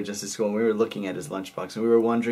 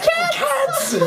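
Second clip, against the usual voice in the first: shocked cry. Retold or imitated. Friends see the lunch pail spill open, high shocked cry. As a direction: shriek it as if the joke came true. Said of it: "me and Tim went like".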